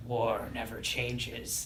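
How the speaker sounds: low, slow gruff Snake voice. Retold or imitated. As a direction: growl it out like Solid Snake. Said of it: "in the Snake voice".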